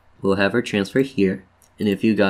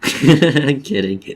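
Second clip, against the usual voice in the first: laughing; 6 semitones higher, 6 dB louder, much slower than usual. Real, not acted. Jokes about a made-up word.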